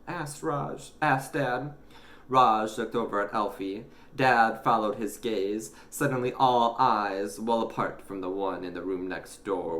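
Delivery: ordinary delivery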